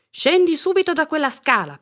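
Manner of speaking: loud, high, urgent order; alarmed parent's telling-off; scolding mother. A narrator's reading. Mother scolds girl on ladder.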